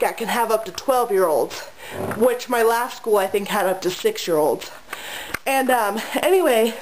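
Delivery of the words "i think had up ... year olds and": normal talking voice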